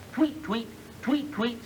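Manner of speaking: high chirping tweets; bird tweets. Acted. Reading a story to gangsters, chirpy high tweets. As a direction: chirp it like a little songbird. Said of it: "said Yellowbird".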